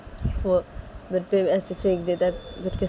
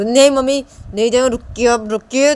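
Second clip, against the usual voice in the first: loud, higher plea to stop; urgent call; 5 semitones higher, 10 dB louder than usual. Acted. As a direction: call out urgently to the departing mother. बेटा जाती मां को पुकारकर रोकता है. (ज़ोर से पुकारकर रोकना)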